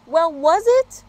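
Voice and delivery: yelling up the tree; shout. Acted. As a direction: call out demanding an answer. Dog shouting to bird.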